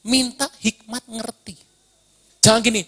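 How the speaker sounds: usual speaking voice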